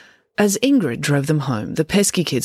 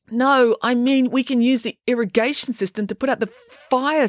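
higher, urgent explanation; 8 semitones higher than usual. Acted. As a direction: press the idea urgently. Joe voiced in story.

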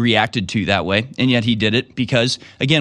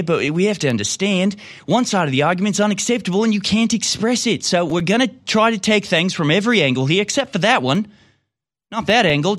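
mocking announcer voice, pitched up; sarcastic host; 6 semitones higher than usual. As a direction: sneer it like a smug TV host.

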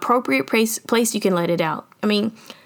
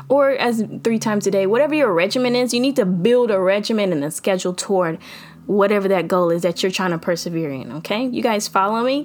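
normal talking voice

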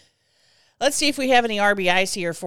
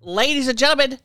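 hammed-up broadcast announcement, louder; mock announcer; 3 semitones higher, 6 dB louder than usual. Host announcing the coffee spill to listeners, mock announcer voice. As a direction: play it like a ringside announcer.

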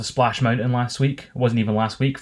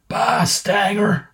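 put-on high, slow, raspy old wrestler growl; slow mocking rasp of a veteran wrestler; Jake the Snake impression; 8 semitones higher, much slower than usual. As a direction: rasp it out like a grizzled old man shuffling off. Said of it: "extremely raspy voice walking away going".

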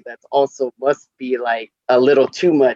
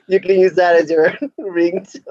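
normal talking voice

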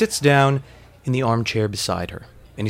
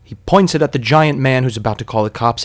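plain reading voice

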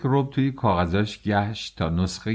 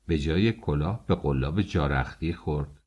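plain reading voice

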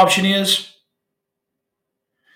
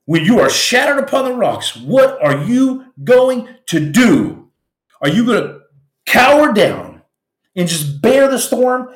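dramatic quote; 6 semitones higher than usual. Retold or imitated. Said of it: "as Edmond Dantes said".

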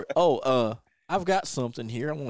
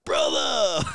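Boogie's exclamation; 7 semitones higher than usual. Retold or imitated. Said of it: "and Boogie's like".

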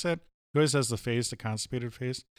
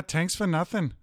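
higher-pitched mock Irish, TH hardened to T; Irish accent; 6 semitones higher than usual. Shown as a demonstration. Co-host showing off an Irish accent on request, mock Irish. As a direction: put on an Irish brogue. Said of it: "could you do an Irish accent".

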